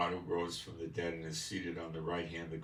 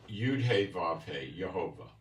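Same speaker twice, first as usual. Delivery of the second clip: normal talking voice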